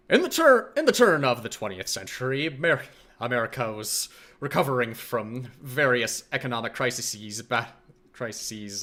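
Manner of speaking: lightly voiced old radio announcer; a hint of 1930s newsreel narration; old-timey radio voice. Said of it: "Old-timey radio voice".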